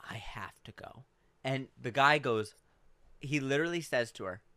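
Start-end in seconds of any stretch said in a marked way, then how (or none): 0.1-1.0 whispered excuse. Retold or imitated. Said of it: "she goes".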